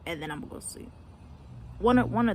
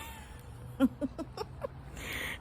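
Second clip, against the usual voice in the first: breathy wheezing laugh at own story; laughing helplessly at the punchline; real laugh; 6 semitones higher, 7 dB quieter than usual. Real, not acted. Vlogger cracking up at her own bus story.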